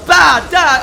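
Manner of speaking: loud mock explosion blast; explosion noise. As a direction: shout out a cartoon boom. Mimicking the probe exploding.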